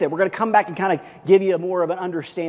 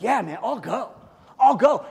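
eager volunteer; 5 semitones higher, 5 dB louder than usual. Retold or imitated. Epaphroditus volunteers.

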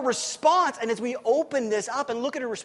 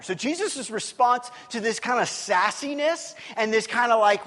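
ordinary delivery

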